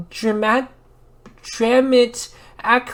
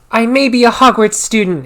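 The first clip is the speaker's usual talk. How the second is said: angry; 2 semitones higher, 8 dB louder than usual. A narrator's reading. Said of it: "Hargirid paused angrily".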